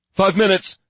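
loud, high answer; calling back. Acted. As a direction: call it back across the room. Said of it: "called back".

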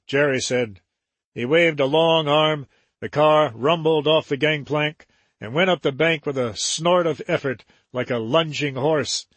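usual speaking voice

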